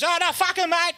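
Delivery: high-pitched mock sob; comic tearful protest; really crying. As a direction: wail it like a hammed-up crybaby. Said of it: "starts really fucking crying".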